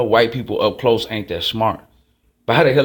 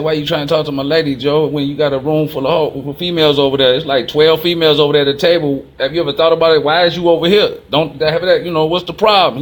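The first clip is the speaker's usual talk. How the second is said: raised, higher voice, calling him out; louder, higher retold challenge to a rival, a touch heated; retold confrontation; 5 semitones higher, 5 dB louder than usual. Retold confronting a man hitting on wife.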